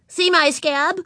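put-on high, proud child voice; proud little girl. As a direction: show it off like a small child. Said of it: "she said proudly".

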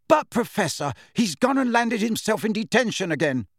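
high, pleading schoolgirl objection; protesting girl. A narrator's reading. Angelina protests to McGonagall about Harry, pleading. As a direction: plead the case with the teacher.

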